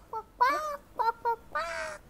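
high quacking duck sounds; duck quacks. Pretend. Child voices toy duck.

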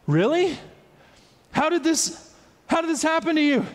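high, astonished questioning; amazed priest. Retold or imitated. Priest astonished at the healed leper. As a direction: ask in wide-eyed wonder.

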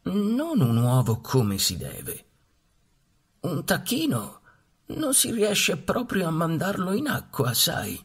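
put-on high, slow know-it-all old duck; the old duck warning her, slowly; vecchia anatra. A narrator's reading. Old duck lecturing mamma duck. (vecchia anatra saputella, voce alta e lenta)

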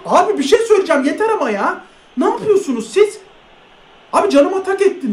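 high, swooping complaint; exasperated protest. Real, not acted. Gamer fed up with the game's calls, exasperated. Complains he has had enough.

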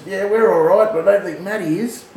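high, loud amused answer; excited reply. Retold or imitated. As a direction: blurt it, half laughing. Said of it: "I said".

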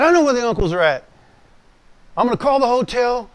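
loud, high, heated retort; angry outburst. Retold or imitated. Angry young minister threatening uncles.

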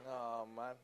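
ordinary talk, nothing marked

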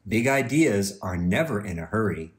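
ordinary delivery, nothing marked

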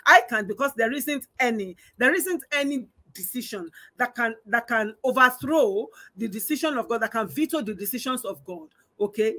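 ordinary talk, nothing marked

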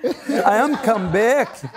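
high, slow, broken-English lament; he tells everyone in broken English, high and pleading; helpless traveller. Retold or imitated. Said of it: "Eu ficava assim pra todo mundo".

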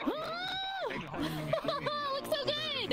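high excited praise. Real, not acted. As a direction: gush over the new look. Admiring new outfit.

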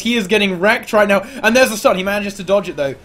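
high-energy esports caster; excited play-by-play. Real, not acted. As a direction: call the fight as it heats up. Live play-by-play of the fight.

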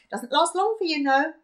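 loud, high gleeful granny voice; excited shouting. Acted. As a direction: keep the delighted shout going. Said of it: "grinning and shouting".

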